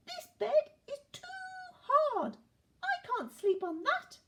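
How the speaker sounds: very high, whiny little character voice; complaining Goldilocks. A narrator's reading. Goldilocks rejects the too-hard bed, high-pitched complaining. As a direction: pipe up in a high, loud complaint.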